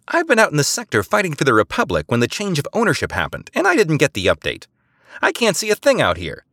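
high, breezy authority; spy coolly asking for the algorithm; casual official request. A narrator's reading. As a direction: ask as if routine. Eliza casually asking favor.

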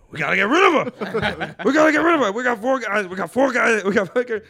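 loud, high Diaz bellow; comic bellowing Diaz's crime plan, loud and high; mock shout. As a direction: boom the plan at the table. Acted Diaz riff.

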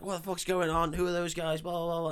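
high-pitched mock-frantic questioning; mock barrage of questions. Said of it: "she's asking loads of questions".

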